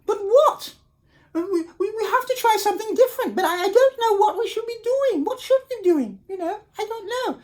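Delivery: high anxious tiny voices; worried little wolves. Acted. Wolves worrying what to do.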